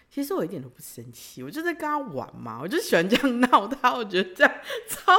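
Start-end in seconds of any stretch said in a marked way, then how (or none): none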